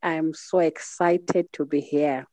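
ordinary talk, nothing marked

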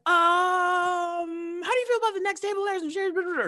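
long high um, then high question; retold nervous caller. Young fan asked for question on radio, nervous squeak. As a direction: squeak it out, put on the spot.

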